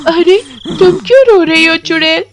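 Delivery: put-on high child voice, gentle and wondering; curious little girl. Komal gently asks the crying witch, high child voice.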